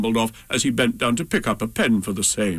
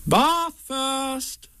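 high, bright cheerful mother voice; brisk and cheery like a busy mum; 13 semitones higher than usual. Acted. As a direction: Kanga cheerfully ordering the bath. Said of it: "said Kanga in a cheerful voice".

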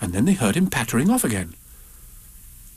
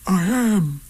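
higher bear voice; Pooh voice; 8 semitones higher than usual. A narrator's reading. Pooh answering Rabbit's shush, higher bear voice. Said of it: "said Pooh".

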